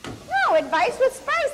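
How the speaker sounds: high, exasperated protest; indignant correction. Real, not acted. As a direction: pitch leaps up to set the partner straight. Protesting the dirty reading.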